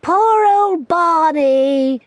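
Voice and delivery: put-on high, loud, drawn-out teasing girl voice; a big sister mocking kindly. Lou teases her little brother Barney, high loud teasing girl voice. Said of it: "said Lou, and laughed".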